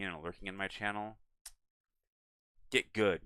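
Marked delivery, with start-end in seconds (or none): 2.7-3.2 mock taunt. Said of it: "No, I'm kidding".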